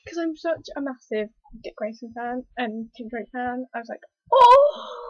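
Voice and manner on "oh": high, loud delighted "Oh!"; excited squeal. Real, not acted. Fan seeing favourite heroes, a loud delighted squeal. Fangirl reaction to favourite characters.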